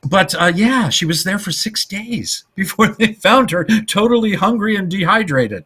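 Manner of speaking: high-pitched, barely composed telling; amused, voice lifted by laughter; laughing through the story. Real, not acted. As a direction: keep talking through the giggles. Continues story while laughing.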